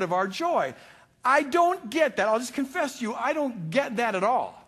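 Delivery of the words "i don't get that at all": ordinary talk, nothing marked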